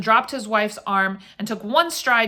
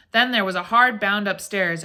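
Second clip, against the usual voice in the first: ordinary delivery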